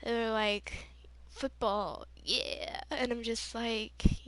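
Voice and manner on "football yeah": dopey mock jock voice; meathead jock. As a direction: imitate a meathead cheering football. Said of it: "like, meathead people".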